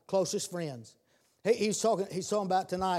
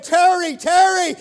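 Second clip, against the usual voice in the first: shouted name, much higher and louder; calling out; 12 semitones higher, 12 dB louder than usual. Acted. God calling out to the preacher Terry in church, shouted. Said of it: "God called out to me".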